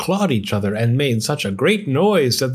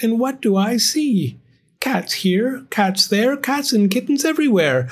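put-on much higher, fretful old wife; dismayed old woman; 10 semitones higher than usual. A narrator's reading. Old wife scolds husband over all the cats, high dismay. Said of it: "she cried".

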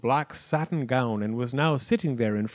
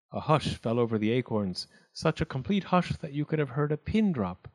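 ordinary delivery

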